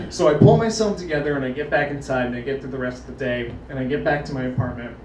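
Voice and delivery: ordinary talk, nothing marked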